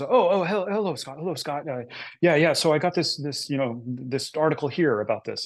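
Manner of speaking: a hint of a Scottish art director fumbling the phone; Scottish accent. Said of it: "she had this very thick Scottish accent".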